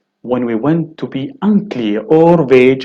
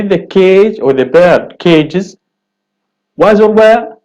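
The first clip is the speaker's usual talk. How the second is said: rising to a high, loud was or were; quizzing question; 6 semitones higher, 5 dB louder than usual. Shown as a demonstration. Teacher quizzing students on was or were, rising high. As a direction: put the question to the class. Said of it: "You would say".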